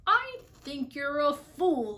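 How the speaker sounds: slow, loud, scornful jeer; mock him like a sour kangaroo. A narrator's reading. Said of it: "laughed the sour kangaroo".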